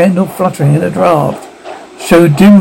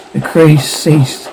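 ordinary delivery, nothing marked

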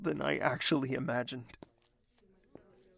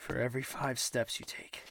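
normal talking voice

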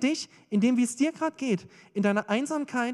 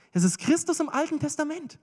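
raised pitch, insistent repeat; emphatic proclamation; 6 semitones higher than usual. Real, not acted. Preacher repeats the revelation to the congregation, emphatically. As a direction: lift the voice to proclaim it.

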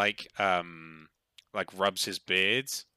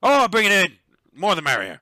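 loud, high, jovial call; boisterous tavern-keeper's shout; hearty dwarf welcome; 10 semitones higher, 10 dB louder than usual. Acted. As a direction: bellow a welcome as a dwarf barkeep. Dwarf welcomes player's bird too.

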